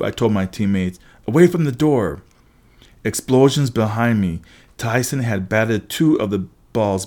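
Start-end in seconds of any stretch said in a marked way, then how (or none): 1.3-2.2 raised, higher-pitched order; urgent command. A narrator's reading. Telling teammates to clear the door, mildly urgent command. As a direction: warn them urgently.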